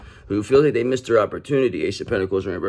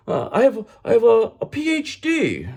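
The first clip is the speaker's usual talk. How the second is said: much higher, put-on squeaky voice of a pompous phony; mock indignant fraud; 12 semitones higher than usual. Tarot reader mocking the Emperor in Reverse, high squeaky indignant voice. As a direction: ham up an offended fake acting innocent. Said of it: "That's how they talk".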